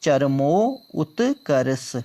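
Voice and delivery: चरमोत्कर्ष said very slowly, syllable by syllable; slow correct form. Shown as a demonstration. Teacher slowly dictates चरमोत्कर्ष to the class. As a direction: stretch out चरमोत्कर्ष for students to note. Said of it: "क्या होता है?".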